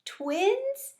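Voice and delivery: high, puzzled rise; a guinea pig who has never heard the word; confused question. A narrator's reading. Gigi confused about twins, high puzzled question.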